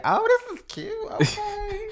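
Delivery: very high, put-on bougie voice; mock fancy lady cooing over a shop; bougie shopper. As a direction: play the posh shopper admiring things. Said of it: "I will go in places like".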